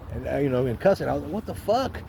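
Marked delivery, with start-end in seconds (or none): 1.3-2.0 high-pitched, indignant question, kept soft; irate. Retold or imitated. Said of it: "I am irate".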